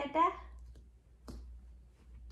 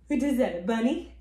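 ordinary delivery

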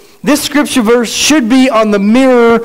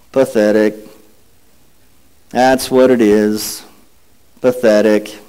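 gloomy Eeyore; 12 semitones lower than usual. Acted. Preacher reading Eeyore to the congregation, mopey low drone. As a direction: sigh it out like a glum old donkey.

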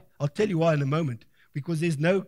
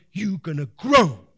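loud, rising preacher's command to the seed; loud commanding call to a planted seed; 4 semitones higher, 5 dB louder than usual. Acted. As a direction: order the seed to grow, full voice. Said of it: "We're gonna say".

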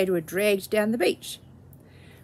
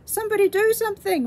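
high, louder and fast, frantic; a panicked giant calling out quickly; urgent plea; 10 semitones higher, 4 dB louder, faster than usual. A narrator's reading. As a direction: beg for help in a hurry. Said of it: "rumbled the giant".

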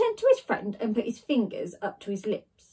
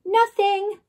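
lie; 9 semitones higher, 5 dB louder than usual. A narrator's reading. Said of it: "lied Ned".

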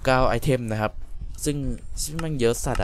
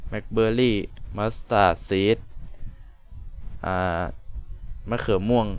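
ordinary delivery

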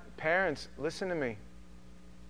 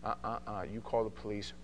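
quiet firm warning; 5 semitones lower, 8 dB quieter, faster than usual. Retold or imitated. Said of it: "And I go".

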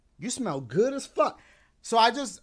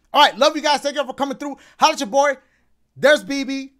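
loud cheerful sign-off; 5 semitones higher, 9 dB louder, faster than usual. Real, not acted.

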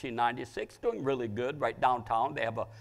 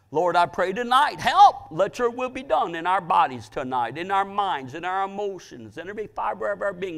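raised, urgent preacher's prayer; impassioned praying aloud, high and loud; cry for help; 5 semitones higher, 6 dB louder than usual. Acted. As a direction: cry out to God, punching each plea for help. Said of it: "I call it a cry for help".

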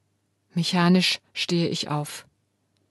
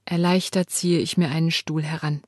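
ordinary delivery

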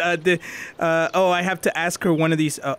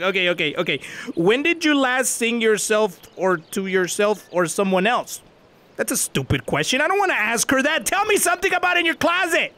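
usual speaking voice

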